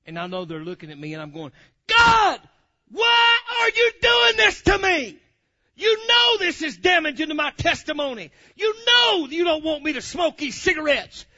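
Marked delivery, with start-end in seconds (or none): 1.9-11.3 high loud anguished shout, slow at the ends; hollering. Retold or imitated. Man yelling at God in traffic, loud anguished hollering. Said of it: "I was hollering out".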